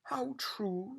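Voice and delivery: high, mournful royal sigh; a touch of self-pity; unhappy. A narrator's reading. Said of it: "said the unhappy king".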